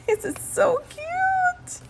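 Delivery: high, slow, gushing coo; drawn-out high squeal of cuteness. Real, not acted. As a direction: coo over something adorable. Gushing over cute item.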